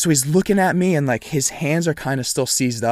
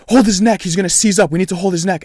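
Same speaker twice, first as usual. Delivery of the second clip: high, loud urgent orders, imitated; retold frantic command; panicking bystander; 5 semitones higher, 5 dB louder than usual. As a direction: call out like an alarmed onlooker.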